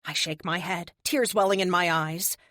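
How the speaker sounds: plain reading voice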